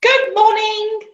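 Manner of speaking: very high, slow squeaky sing-song greeting. Acted. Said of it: "sang Steamy in his squeaky voice".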